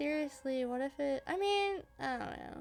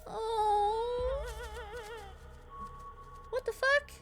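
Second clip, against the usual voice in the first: high, loud startled disbelief; shocked outburst; 10 semitones higher, 4 dB louder than usual. Real, not acted. Player shocked at what just ran past. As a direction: blurt out in real shock.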